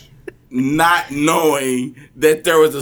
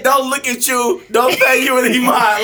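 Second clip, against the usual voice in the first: quoting her back, pitched up and loud; mocking her words back; 8 semitones higher, 4 dB louder than usual. To his cohost, a mocking high quote of her rules. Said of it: "You literally just said".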